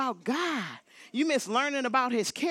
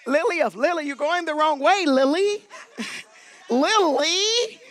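raised voice climbing in pitch and volume; insistent rising name-call; 4 semitones higher, 5 dB louder than usual. Real, not acted. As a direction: call after someone heading off the wrong way. Calling a person going wrong way.

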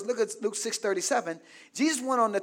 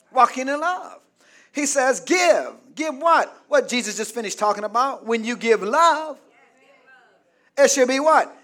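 loud, raised, driving preacher's voice; full-force preaching; 5 semitones higher, 10 dB louder than usual. Acted. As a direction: preach it hard, punching key words high and loud.

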